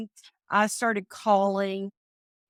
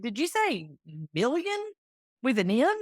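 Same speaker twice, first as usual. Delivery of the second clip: retold incredulous question; 2 semitones higher than usual. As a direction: pitch leaping up on "million", with pauses round it. Husband reacts to million dollar deal.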